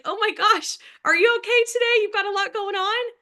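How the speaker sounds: high, effusive chat-up of a stranger; exaggerated friendly concern; gushing concern. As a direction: pitch it way up, fussing over someone. Acted friendly approach to stranger.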